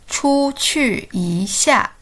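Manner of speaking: very slow, syllable by syllable; slow model for repeating. Shown as a demonstration. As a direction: draw out each syllable for learners to echo.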